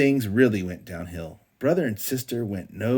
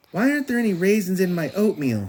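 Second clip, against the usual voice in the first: demanding; 10 semitones higher, 6 dB louder, faster than usual. A narrator's reading. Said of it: "she demanded".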